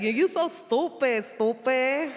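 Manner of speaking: mock the girls' thick Puerto Rican accent. Mimicking the Bronx girls in a thick Puerto Rican accent. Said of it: "that really thick Rosie Perez Puerto Rican accent".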